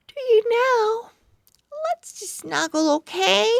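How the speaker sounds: put-on high, tender girl voice; sweet girl voice. Bella greets her pug home, sweet. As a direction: gush over the dog, then sleepily.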